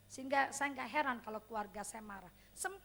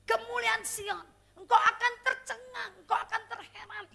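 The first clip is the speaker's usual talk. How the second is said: joyful declaration; 7 semitones higher, 9 dB louder than usual. Real, not acted. Preacher declaring scripture with laughter.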